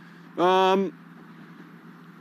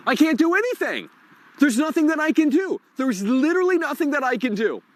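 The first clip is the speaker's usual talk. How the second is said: higher-pitched frustrated complaint; exasperated outburst; 8 semitones higher than usual. Real, not acted. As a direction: let the real frustration push it up. Streamer exasperated, nothing works.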